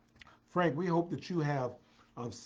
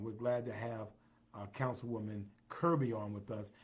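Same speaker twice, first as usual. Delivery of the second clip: ordinary delivery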